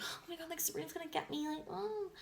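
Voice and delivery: high, soft panicked squeak; mock panicked squeak. Imitating the roommate's panic at being caught, high and hushed.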